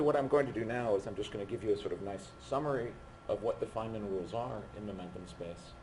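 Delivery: ordinary talk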